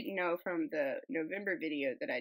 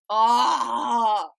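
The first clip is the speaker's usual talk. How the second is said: long, loud "ahh" of delight; loud admiring exclamation; delighted cry; 4 semitones higher, 13 dB louder than usual. Real, not acted. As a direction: let out a big drawn-out awed cry. Delighted cry at wooden artwork.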